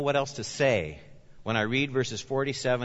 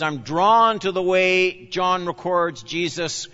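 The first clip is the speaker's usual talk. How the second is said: usual speaking voice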